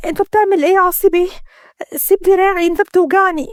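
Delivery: fast, high, panicked plea of pain; pained protest. A narrator's reading. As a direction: beg him to let go of her arm. Arm twisted, in pain. (احتجاج متألم سريع وعالي)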